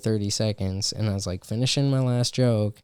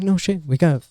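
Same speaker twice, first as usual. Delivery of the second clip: fast, louder sarcastic reply; exasperated retort; 6 semitones higher, 3 dB louder, faster than usual. Retold or imitated. Comic answering the bombing remark, exasperated. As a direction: snap back at the obvious. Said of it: "I'm like".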